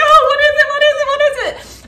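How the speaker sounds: high, loud, eager squealing; excited. Retold or imitated. Woman awaiting her Christmas gift, excited. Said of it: "I'm getting so excited".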